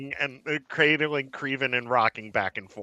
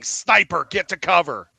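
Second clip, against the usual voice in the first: raised, higher warning yell; 5 semitones higher, 5 dB louder than usual. A narrator's reading. Falling soldier warning the others of a sniper, yelling. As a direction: call out urgently as you go down. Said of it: "Garrick goes down while yelling".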